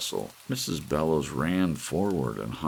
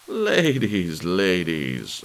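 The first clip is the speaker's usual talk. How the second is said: plain reading voice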